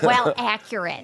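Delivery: slow, sweet church lady shade. Acted. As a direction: drawl it like a prim church lady. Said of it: "this is a fake voice".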